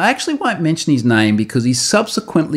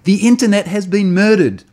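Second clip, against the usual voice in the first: higher-pitched mock outcry; all caps; 8 semitones higher, 3 dB louder than usual. Reading an angry commenter's all caps, mock outcry. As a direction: read it as capital letters, hammed-up alarm. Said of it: "and then all caps".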